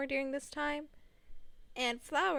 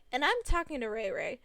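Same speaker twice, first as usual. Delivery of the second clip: ordinary delivery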